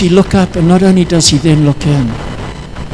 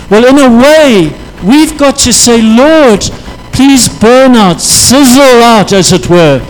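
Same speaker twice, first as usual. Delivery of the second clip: impassioned plea; 3 semitones higher than usual. Acted. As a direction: plead with God at full force.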